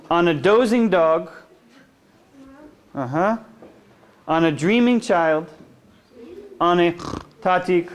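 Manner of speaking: sing-song picture-book reading; gentle read-aloud for little kids; reading to children. Acted. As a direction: lull them along the bedtime rhyme.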